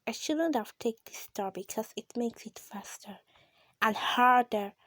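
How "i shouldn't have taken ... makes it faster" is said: hushed, softer whisper while playing; scared whisper. Real, not acted. Tense gamer whispering to viewers mid-level, scared hush. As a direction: whisper it as if the game might hear. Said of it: "I'm so scared being there".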